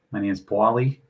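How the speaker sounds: a light go at a Philadelphia accent; attempted Philadelphia accent. Shown as a demonstration. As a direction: imitate Philly speech, not quite convincingly. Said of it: "Always try my best to replicate it, but I can't".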